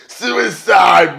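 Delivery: high, loud burst on the name; hyped name shout. Pretend. Reviewer glad to see the wrestler, hyped shout. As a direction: call it out like a hyped fan.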